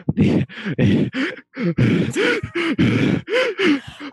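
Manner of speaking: long high-pitched laughing fit; high squealing laugh. Real, not acted. Player breaks down laughing.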